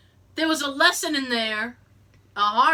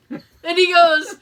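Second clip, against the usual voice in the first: laughing; 6 dB louder than usual. Real, not acted. Retelling while laughing hard.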